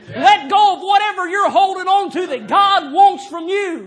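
high, loud, hammering revival preaching; preaching at full force. Acted. Preacher urging the church to let go, shouting. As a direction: drive it home like a tent-meeting preacher, shouting.

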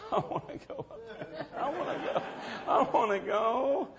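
high, whispered, laughing plea; eager wanting. Retold or imitated. Preacher remembering his longing during the skit, laughing whisper. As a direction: beg to join them, laughing. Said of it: "I was sitting there thinking".

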